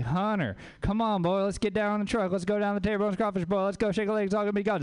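hammed-up Louisiana good old boy, pitched high; garbled Southern holler, barely language; Louisiana accent. Shown as a demonstration. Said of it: "Does that even sound like language to y'all".

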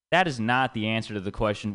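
normal talking voice

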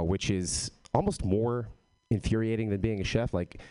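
ordinary talk

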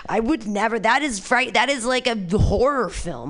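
ordinary delivery

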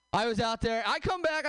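usual speaking voice

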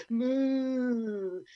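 loud, high, long moo; drawn-out cow moo. Acted. Cow voice continues.

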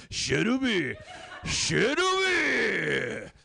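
"Shirubi" sung out high and stretched; Japanese pronunciation. Acted. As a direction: call "Shirubi" playfully, drawing out the last syllable. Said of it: "is pronounced Shelby".

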